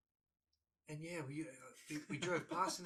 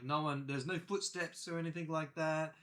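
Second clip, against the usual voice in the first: ordinary delivery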